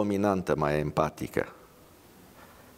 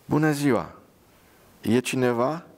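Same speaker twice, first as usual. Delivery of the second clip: calling out; 6 semitones higher than usual. Acted. Nurse calls into the room.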